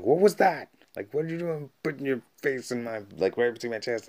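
high, loud mock telling-off; hammed-up indignant demand; acted fired-up outrage. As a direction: imitate an outraged woman confronting a man. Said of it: "like, getting all fired up".

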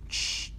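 sharp voiceless "shh"; firm shush. Acted. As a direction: hush a rowdy group. Passengers shush the kids.

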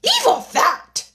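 very high, loud, drawn-out command; screamed. A narrator's reading. The Queen stops the gardeners bowing, screaming. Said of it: "screamed the Queen".

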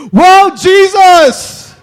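huge, drawn-out cry of wonder; amazed shout. Acted. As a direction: shout it high, loud and slow. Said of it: "get your mind renewed and say".

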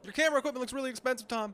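high, rapid needling; mock heckling. Hounding Tom about his gear, mock pestering. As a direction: rattle it off like a pushy snoop.

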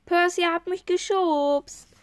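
high, loud, drawn-out shriek of accusation; girl screeching an accusation from the fountain. A narrator's reading. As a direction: shriek it out like a spoiled girl caught out.

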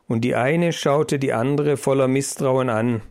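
plain reading voice

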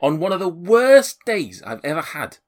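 high, aggrieved protest in a put-on accent; affronted, high-pitched character rant; indignant homeowner. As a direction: protest as if your home was invaded. Man defends his home to intruders.

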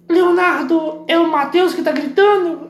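high, slow mock-mother voice; imitating his mother. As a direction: squeak it out like an annoyed mom on the phone. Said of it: "E falou assim".

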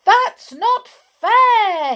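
slow, very high, loud wail; indignant cry. A narrator's reading. Mister Tickle has lost his long arms, crying out indignantly. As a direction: protest like a thwarted child, drawn out. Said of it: "he cried".

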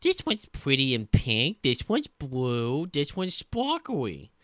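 drawn-out, cutesy-silly admiring voice; mock delight, slow and singsong; mock shell collector. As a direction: coo over each shell, dragging the words. Mock shell collector in pirate game.

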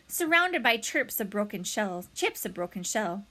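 plain reading voice

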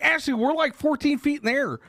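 high-pitched protest that it was high up; exasperated protest. Retold or imitated. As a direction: plead with your wife that you really were up high. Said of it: "I was like".

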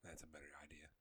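soft whispered aside. Real, not acted. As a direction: whisper it, barely audible. Aside after the rant.

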